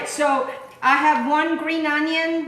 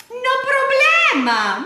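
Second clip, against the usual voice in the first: playful mock Spanish; 12 semitones higher, 5 dB louder, slower than usual. Playful cooking aside to viewers.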